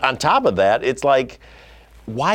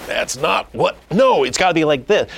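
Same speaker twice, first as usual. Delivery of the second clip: insistent objection; 7 semitones higher, 3 dB louder than usual. Retold or imitated. Acting out a controlling director's objection.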